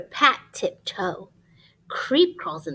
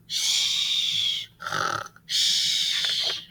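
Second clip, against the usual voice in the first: long unvoiced hush; shushing. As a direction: hush them so the bear sleeps on. Hushing children near sleeping bear.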